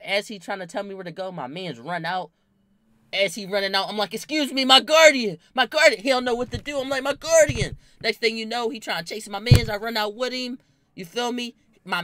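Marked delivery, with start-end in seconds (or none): 4.1-6.0 loud frantic call for guardian; fake-panicked shout for the guardian. Yelling for guardian to distract. 7.0-7.8 loud frantic call for guardian; fake-panicked shout for the guardian. Yelling for guardian to distract.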